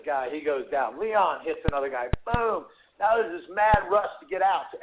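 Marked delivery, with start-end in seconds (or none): 2.2-2.6 punch sound effect. Retold or imitated. Fight story punch sound.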